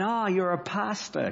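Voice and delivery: a high, slow, half-hearted American drawl; mock American accent. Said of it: "I can't do an American accent".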